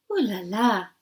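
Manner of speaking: light French purr; a hint of a French lady; soft French accent. A narrator's reading. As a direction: Ooh la la with a French lilt. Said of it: "in her soft French accent".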